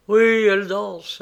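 plus fort, plus haut et traînant; répéter avec une amertume appuyée; reprise amère. A narrator's reading. Il reprend ses mots avec amertume. (amertume jalouse)

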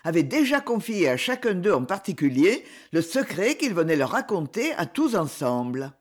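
ordinary delivery

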